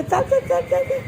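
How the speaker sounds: high, rapid-fire coaxing; excited urging. Real, not acted. Urging the child to walk, high excited coaxing. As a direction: chant it high to coax them along.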